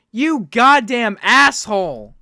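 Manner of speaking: loud, drawn-out angry insult; indignant cursing, every word leaned on; outraged. Real, not acted. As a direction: hurl the insult slowly and loudly. Cursing William over the cake.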